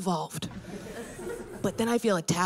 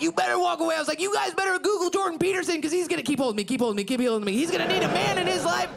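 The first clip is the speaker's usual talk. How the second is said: higher, fast taunting shouts; hollering; 8 semitones higher, much faster than usual. Retold or imitated. Comic taunting the dad, fast raised shouts. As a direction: jeer at the men walking away. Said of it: "We're hollering at each other".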